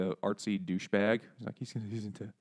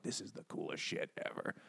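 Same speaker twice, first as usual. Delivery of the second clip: hushed, voiceless amazement; whispered awe; 4 semitones lower, 11 dB quieter than usual. Retold or imitated. Kid whispering about a music video. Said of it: "I was like".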